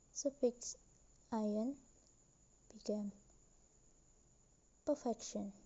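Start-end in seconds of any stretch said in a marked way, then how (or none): 4.9-5.5 perfection with the stress on fec; second-syllable stress. Shown as a demonstration. Teacher showing stress moved by a suffix, perfection stressed on fec. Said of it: "So the stress moved".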